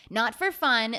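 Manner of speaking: ordinary delivery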